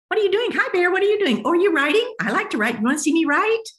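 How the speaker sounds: high, loud, eager goose voice; chatty goose. Retold or imitated. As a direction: chirp it out like a pushy talkative goose. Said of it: "goose says".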